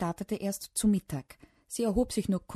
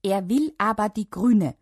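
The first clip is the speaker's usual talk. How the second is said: loud, higher emphatic sing-out; chorused; 4 semitones higher, 9 dB louder than usual. A narrator's reading. Sister and dad answering mum in chorus, loud. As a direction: chime in loudly on the child's behalf. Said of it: "sagten die Mia und der Papa im Chor".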